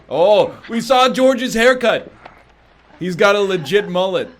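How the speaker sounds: loud, amused exclamation; excited, loud and high; excited outburst. Real, not acted. Reacting to the haircut.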